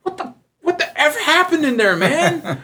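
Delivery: stunned coworkers, half laughing. Retold or imitated. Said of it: "And they're like".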